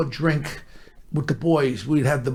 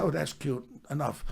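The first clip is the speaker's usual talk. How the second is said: retold quiet warning; 2 semitones lower, 10 dB quieter than usual. Low warning to kissing man.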